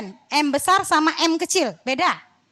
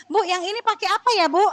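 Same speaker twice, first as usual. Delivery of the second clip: high-pitched mock student question; high, sing-song mimicry of a student; mimicking clueless student; 6 semitones higher than usual. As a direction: imitate a pestering student, pitched well up. Mimics students asking after being told.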